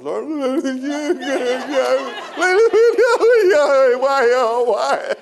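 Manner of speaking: high, loud babbling wail; hammed-up praying in tongues while being spanked; mock tongues wailing. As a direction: wail gibberish to the Lord, comically. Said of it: "I was speaking in tongues".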